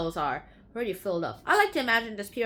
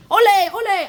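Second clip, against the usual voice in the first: cheering ole; 10 semitones higher, 12 dB louder, much faster than usual. Acted. Celebratory chant at end.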